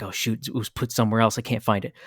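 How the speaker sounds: quiet oh-shoot to himself; muttering to himself. Retold or imitated. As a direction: mutter it so only you hear. Kid muttering alone in shed.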